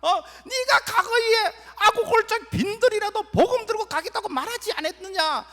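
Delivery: high, loud, impassioned reminder; Jesus pressing a lapsed believer, high and loud; Jesus calling out. Acted. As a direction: call them back to their old vow.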